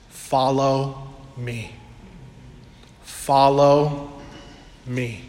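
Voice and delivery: slow, low and tender, drawing them in; gentle call. Acted. Preacher voicing Jesus calling the listener, slow and tender.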